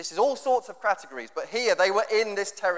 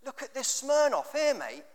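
higher, mocking lads' banter voice; higher imitation of a lad bragging about a bargain; lad at the airport; 9 semitones higher than usual. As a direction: take off an excited lad spotting cheap vodka. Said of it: "and this lad says".

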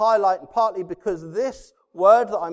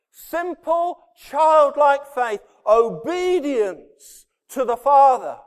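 full-force preaching; 7 semitones higher, 7 dB louder than usual. Acted. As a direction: build the congregation up to a rousing peak. Sermon climax, preaching hard.

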